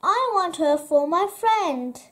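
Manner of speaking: put-on wilful little robber girl; demanding robber girl. A narrator's reading. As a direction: insist like a child who gets her way. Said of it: "said the robber girl".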